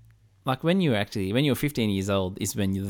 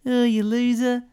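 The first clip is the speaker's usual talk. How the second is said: put-on higher and louder, teasing teen; teen on the phone; 14 semitones higher, 5 dB louder, slower than usual. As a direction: sneer it like a fifteen-year-old. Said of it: "it'd be like".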